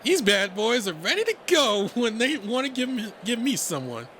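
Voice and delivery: high, loud, showy bragging; swaggering, excited buddy voice; cocky boast. Acted. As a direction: brag about your muscles with gusto. Redline boasting, flexing his biceps.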